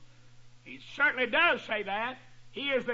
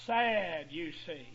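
drawn-out sad delivery; 7 semitones lower, 3 dB quieter, much slower than usual. Shown as a demonstration. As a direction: pause before sad, then the voice sinks low and soft on you see. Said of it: "And, and I say it like this".

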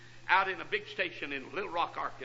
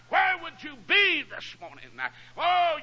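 high, loud preacher's cry; preacher crying out to the congregation; preaching shout; 8 semitones higher, 5 dB louder than usual. Real, not acted. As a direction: build to a ringing shout. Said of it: "preacher building to shout".

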